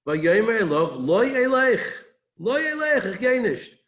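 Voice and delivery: high, loud, firm no; emphatic refusal. Retold or imitated. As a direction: plant your feet and refuse outright. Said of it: "it's not just flat, it's emphatic".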